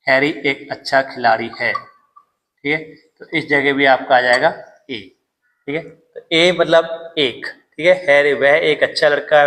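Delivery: ordinary delivery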